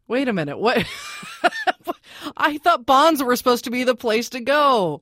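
playful, rising incredulity; mock disbelief. Host challenges expert on zero percent bonds, mock disbelief. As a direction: act baffled by the bad news, lifting the pitch. Host plays baffled bond buyer.

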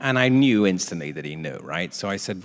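ordinary talk

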